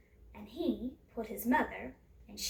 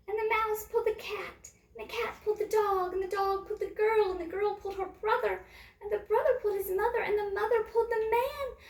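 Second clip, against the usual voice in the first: higher, faster, louder rush through the chain; excited building chain; 8 semitones higher, 3 dB louder than usual. A narrator's reading. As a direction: race through it as the pull builds.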